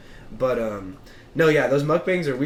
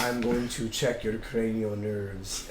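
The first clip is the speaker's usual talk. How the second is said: put-on very slow, low Australian-accented murmur; deep Australian accent; 5 semitones lower, 8 dB quieter, much slower than usual. Imitating a YouTube ASMR doctor, slow low Australian accent. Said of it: "his voice is so deep".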